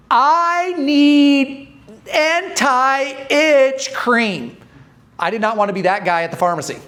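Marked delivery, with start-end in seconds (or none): 0.1-4.6 very slow, high, drawn-out mock condescension; talking down like they're dumb. Customer mocking pharmacy staff, patronising and very slow. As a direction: spell it out as if to a slow child. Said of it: "talk to them like they're dumb".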